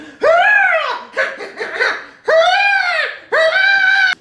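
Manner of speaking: very high, loud shrieking squeals; imitated creature noises. Reviewer mimicking the noises he's making, loud high shrieks. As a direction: shriek like a panicked animal, far above your own voice. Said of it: "did you hear those noises he's making".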